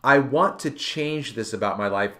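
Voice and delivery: ordinary delivery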